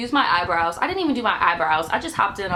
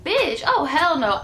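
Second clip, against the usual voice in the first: high-pitched outraged sass; indignant disbelief; 6 semitones higher, slower than usual. Retold or imitated. After the crash, a high sassy refusal. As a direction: pitch it up, full of attitude. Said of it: "and I'm like".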